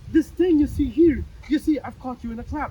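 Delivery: loud, triumphant gotcha; spring the trap on him loudly; triumphant outburst. Acted. Father springing his logical trap on the manager, loud and triumphant.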